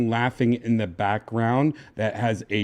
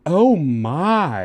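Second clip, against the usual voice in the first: higher, louder effeminate exclamation; put-on effeminate voice, pitched up; 6 semitones higher, 6 dB louder than usual. Shown as a demonstration. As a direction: demonstrate a campy effeminate exclamation. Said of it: "an effeminate voice".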